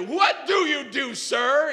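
acted irate demand; mock yelling. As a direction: imitate a man yelling at someone. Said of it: "He's, like, yelling at me".

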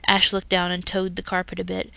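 ordinary delivery, nothing marked

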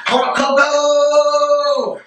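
high, very slow, stretched out; hyped announcement. Acted. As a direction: announce it like a hype man.

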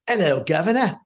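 hammed-up Cockney greeting; mock British accent. Co-host imitating a British Christmas Carol performance, mock British accent. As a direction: put on a cartoonish old-London British accent. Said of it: "felt like I'm watching A Christmas Carol".

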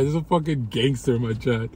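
usual speaking voice

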